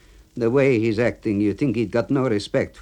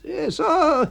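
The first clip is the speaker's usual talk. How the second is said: put-on much higher, louder gruff old friend; 4 dB louder than usual. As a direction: play the cemetery clerk high and loud. AB voiced.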